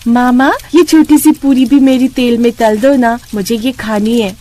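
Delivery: put-on little girl's voice. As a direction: ask mum sweetly like a small child. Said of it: "Muniya ... अपनी माँ से कहती है".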